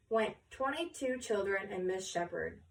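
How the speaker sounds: usual speaking voice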